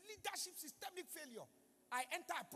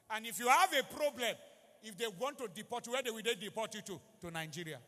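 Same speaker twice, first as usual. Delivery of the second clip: loud pleading sermon, building to the congregation; a pastor appealing loudly to his flock; preaching at full force; 9 dB louder than usual. Acted. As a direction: drive it home to the whole church. Preacher pleads about leaving Nigeria.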